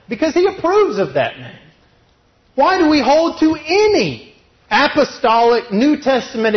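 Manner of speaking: loud, high, driving sermon voice; fiery preaching. Acted. Preacher drives his point home to the church, fired up. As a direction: preach it hard to the congregation.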